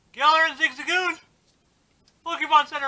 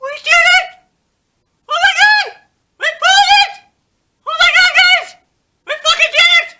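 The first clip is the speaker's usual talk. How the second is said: ecstatic screaming, much higher and louder; giddy relief; 12 semitones higher, 11 dB louder than usual. Real, not acted. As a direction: scream with joy at the top of your lungs. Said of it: "calm down, [name]".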